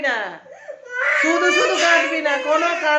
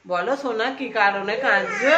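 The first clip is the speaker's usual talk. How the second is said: tender coaxing; 6 semitones lower, 4 dB quieter than usual. Real, not acted. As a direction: lower and softer, sweet talk. Parent soothing crying child.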